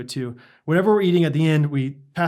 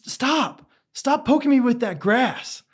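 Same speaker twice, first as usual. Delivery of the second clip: lightly voiced kid whining; whiny kid; 9 semitones higher, slower than usual. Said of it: "And then I hear him say".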